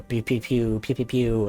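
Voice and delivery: pew pew laser effects; mouth blaster sounds. Retold or imitated. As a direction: make playful laser gun noises. Space battle sound effects.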